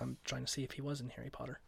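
fast real whisper. Real, not acted. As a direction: whisper quickly between takes. Answers while recording paused.

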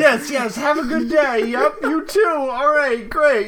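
high mock sing-song goodbye. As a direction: chirp it falsely bright, then go home to cry. Mocking the woman's goodbye.

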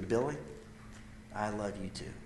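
soft, low, understated reply, building no volume; tearful tenderness, retold. Tearful dad answering his son, quiet and tender. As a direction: quietly imitate a father moved to tears. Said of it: "with tears in his eyes".

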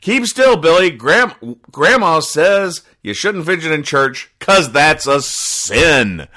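put-on a child scolding Billy for fidgeting, high and bossy; bossy kid voice. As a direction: boss your brother around like a little know-it-all. Kid scolding brother in comic.

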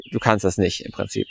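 deutlich leiser und tiefer, nur angedeutet entmutigend; leiser Co-Pilot. Retold or imitated. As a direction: murmel es wie eine kleine Stimme im Hinterkopf. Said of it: "der Co-Pilot sagt halt". (Co-Pilot redet dem Zuhörer dezent leise und tiefer Mut ab)

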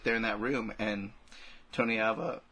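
normal talking voice

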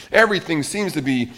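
ordinary talk, nothing marked

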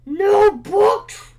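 very loud booming dragon bellow; dragon rumble. A narrator's reading. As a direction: bellow it from the belly. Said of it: "rumbled the dragon".

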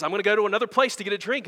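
put-on a frightened girl blurting it out quick; hurried scared girl. Jill voiced backing away from the lion, scared and fast. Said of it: "She says".

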